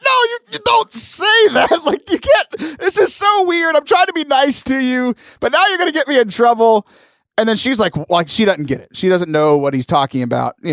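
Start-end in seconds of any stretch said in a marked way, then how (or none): 0.0-6.9 high-pitched, flustered pleading; panicked protest. Retold or imitated. Gamer begs kid not to name him, mock panic.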